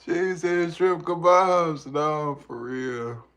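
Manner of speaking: high, loud, drawn-out amused cry; tickled, voice shooting up loud; cracking up. Real, not acted. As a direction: say it slowly through real amusement. Amused by a chat reply.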